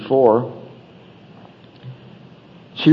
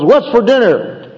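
high-pitched, drawn-out husband's growl; grumpy husband demanding, slow and raised; mock growl; 8 semitones higher, 3 dB louder than usual. Husband walking in from a bad day at work, mock growl. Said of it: "walks in the door and growls".

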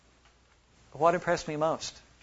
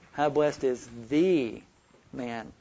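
THE drawn out as "thee", then a break; slow reading leaning hard on THE; stress on THE; 3 semitones lower, slower than usual. Shown as a demonstration. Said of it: "Emphasizing one word after another".

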